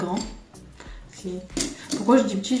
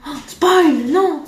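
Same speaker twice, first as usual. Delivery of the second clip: higher and louder, a sudden "oh non!"; caught-out spoiler yelp; 7 semitones higher, 6 dB louder, much slower than usual. Real, not acted. Telling viewers about the seamstresses, a startled cry. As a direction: stop yourself mid-reveal with a yelp. Catches herself revealing a surprise.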